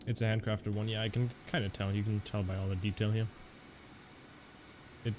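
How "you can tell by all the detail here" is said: usual speaking voice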